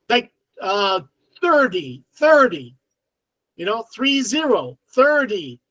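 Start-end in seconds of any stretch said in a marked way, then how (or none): 1.4-2.7 thirty said as 'thur-dee'; D sound. Shown as a demonstration. Showing American habit to learners, thirty as 'thur-dee'. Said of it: "the T with a D sound". 4.9-5.6 thirty said as 'thur-dee'; D sound. Shown as a demonstration. Showing American habit to learners, thirty as 'thur-dee'. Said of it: "the T with a D sound".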